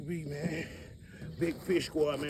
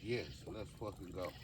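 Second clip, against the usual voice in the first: quiet triumph; 8 semitones lower, 11 dB quieter than usual. Real, not acted. Quiet triumph after catch.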